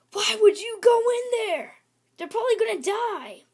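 horrified friend shouting a warning; fast frightened outburst; alarmed gasp. Acted. Character alarmed at friend entering.

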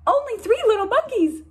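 high, fast gasp of alarm; a mom who just lost count again; gasping Mama. Acted. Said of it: "gasps Mama".

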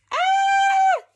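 very high delighted squeal; sudden high happy shriek; excited squeal. Real, not acted.

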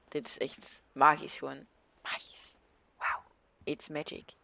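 awed whisper. Real, not acted. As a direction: breathe it in hushed wonder. Awed by scenery, hushed.

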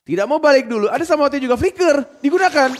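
high, loud, rapid-fire hype call; excited play-by-play. Acted. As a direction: ride the team fight at full volume. Hype team fight call.

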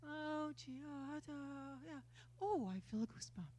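soft, slow, unimpressed mock reaction; a mild mock nobody-cares murmur; mock underwhelmed. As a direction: shrug it off like nothing happened. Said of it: "It wasn't like".

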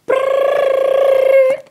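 loud high buzzing brrr; imitated phone ring.

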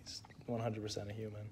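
hushed joking whisper. Acted. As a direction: whisper it like a secret aside. Joking aside about Nick Stick.

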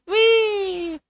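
long high wee; high squeal. Real, not acted. Sign-off squeal.

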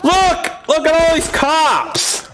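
high-pitched cry of disbelief; exasperated outburst. Real, not acted. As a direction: wail it in real frustration. Outburst at police appearing in game.

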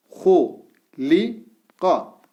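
Gu said with a thick back U, each syllable drawn out; syllable drill with thick vowel. Shown as a demonstration. Teacher reads the thick-vowel syllables to students, slowly. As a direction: read out each syllable apart. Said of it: "buna da U sesi veriyoruz".

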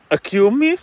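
high, loud affronted gasp; a girl stunned when the old woman turns into the goddess; shocked excuse me. Acted. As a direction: sound caught off guard and offended. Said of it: "Y Aracne dijo".

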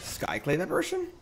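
surprised. Real, not acted. Verbaasd over kaartkeuze.

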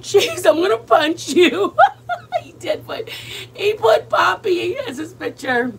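laughing through the words. Real, not acted. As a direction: crack up mid-sentence. Nephew's dog profile picture.

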